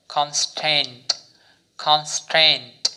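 Constant drawn out slowly twice; slow model word. Shown as a demonstration. Teacher drills constant for learners, slowly. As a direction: say constant slowly and carefully, then repeat it. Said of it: "আমি যেভাবে করেছি ঠিক এভাবে practice".

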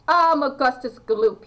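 put-on loud, announcing himself as a boy; loud boy's voice in a story; boy's self-introduction. A narrator's reading.

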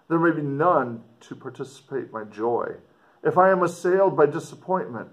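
put-on measured read-aloud of an old letter. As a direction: read it like the writer speaking to his sister. Teacher resumes reading the letter.

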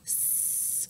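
long unvoiced snake hiss. Shown as a demonstration. Reader showing children a snake, long hiss. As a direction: hiss softly on one long S.

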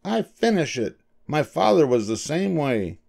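high-pitched, resolute character voice; little dentist stating his principle; firm. A narrator's reading. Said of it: "said the dentist firmly".

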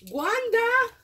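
high, loud delighted call; thrilled shout of recognition; excited cry of a name. Real, not acted. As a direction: call her name out in joy. Recognizes the friend's gift.

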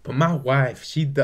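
choked-up voice, close to tears; voice cracking. Retold or imitated. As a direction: let the voice crack with emotion. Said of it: "Don's voice starts to crack".

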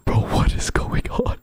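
hushed whisper, hiding; whispering while hiding. Real, not acted. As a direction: whisper so they don't hear. Hiding from enemies upstairs.

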